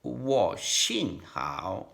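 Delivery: Wo Xin Hao drawn out slowly, each tone shaped; learner's deliberate Mandarin; slow careful Mandarin. Shown as a demonstration. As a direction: sound out Wo Xin Hao syllable by syllable. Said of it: "how to put the tongue".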